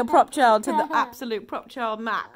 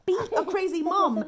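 higher, playful sing-song slogan; raised repeat; 6 semitones higher, faster than usual. Pretend. Mum urging others to be crazy, pitched up playfully. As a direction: lift it up high and playful.

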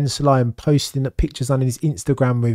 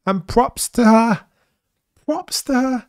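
excited praise; 11 semitones higher than usual. Real, not acted. Ranting in praise of Susie Wolff.

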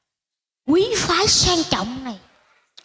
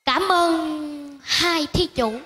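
very slow, drawn-out solemn thanks; mock monk; much slower than usual. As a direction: thank them like a holy man giving a blessing. Seller blesses buyers like a monk.

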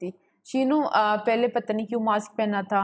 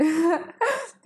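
laughing; 8 semitones higher than usual. Real, not acted. Laughing at viewer's compliment.

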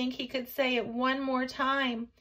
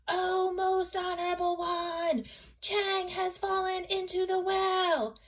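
raised-voice cry; 10 semitones higher than usual. A narrator's reading. Boy crying out to mother who could not hear, raised voice. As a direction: call out high so mother hears. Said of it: "raised his voice and cried".

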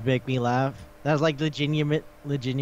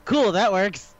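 high, loud, upbeat acceptance; mock eager; 7 semitones higher, 5 dB louder, slower than usual. Bored girl accepting love as fun, mock eager. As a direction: jump on the idea in an imitated chirp.

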